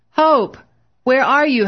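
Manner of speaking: high, loud desperate calling across a crowded room; frantic shout. A narrator's reading. Husband searching a crowded ward for his wife, frantic shout. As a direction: yell her name, frantic with fear. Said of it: "Bill shouted frantically".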